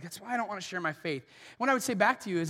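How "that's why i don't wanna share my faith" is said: soft, fast, under-the-breath excuse; muttered excuse. Retold or imitated. Believer admitting why they stay silent, quick soft mutter. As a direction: mumble it quickly, a little sheepish. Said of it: "You're like".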